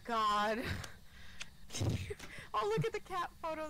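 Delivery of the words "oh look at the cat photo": breathless high giggle; giggling. Real, not acted. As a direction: laugh off the fright, quickly. Laughing off the scare.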